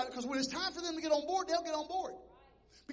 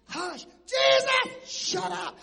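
irritated shout to hush; 5 semitones higher, 7 dB louder, much slower than usual. Retold or imitated. Crowd silencing him.

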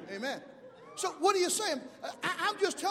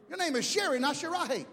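ordinary delivery

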